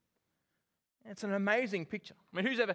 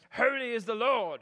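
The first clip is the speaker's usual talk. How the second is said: mock church priest, louder than plain talk; Rowan Atkinson; 5 dB louder than usual. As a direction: put on a comic priest's pronouncement. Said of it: "act as some priest in a church".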